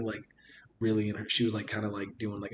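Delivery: ordinary delivery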